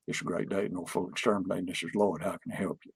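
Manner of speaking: low, soft, rushed monotone greeting; Lloyd's static voice. Retold or imitated. Operations manager answers the phone in his static monotone. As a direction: mumble through the greeting low and fast, no lift. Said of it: "So he said".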